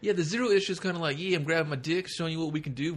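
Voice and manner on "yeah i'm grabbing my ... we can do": high-pitched showing-off voice; mock cocky bragging. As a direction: brag it in a raised voice, playing cocky. Voicing a cocky, bragging comic issue.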